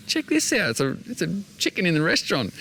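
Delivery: high, squeaky voice for the wandering chicken; silly chicken voice. Retold or imitated. Voicing the chicken wandering through the restaurant, high and silly.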